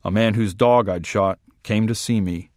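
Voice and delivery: usual speaking voice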